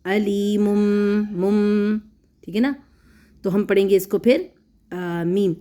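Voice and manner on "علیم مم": very slow, with the tanween held as a humming mim; stretched nasal hum on مم; iqlab demonstration. Shown as a demonstration. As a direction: say علیم مم with the noon turned into a long nasal mmm. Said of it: "پڑھیں گے میم کی آواز کر کے،".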